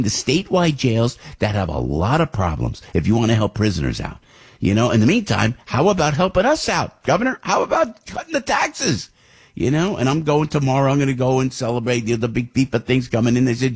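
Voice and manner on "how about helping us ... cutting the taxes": high, mocking plea to the governor; high taunting question; sarcastic challenge. Real, not acted. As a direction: needle the governor.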